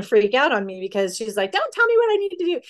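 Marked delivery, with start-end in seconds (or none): 1.5-2.6 much higher, fast mock kid snapping back; defiant daughter. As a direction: play the daughter freaking out at mom.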